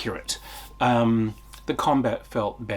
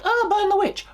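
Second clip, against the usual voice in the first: high-pitched, louder mock mob shout; witch-hunting mob cry; 5 dB louder than usual. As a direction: cry it out like an accusing villager, far higher. Quotes a panicked witch-hunt mob.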